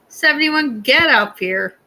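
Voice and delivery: calling out a guest's name. Real, not acted. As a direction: call them up to the stage. Calls the guest up.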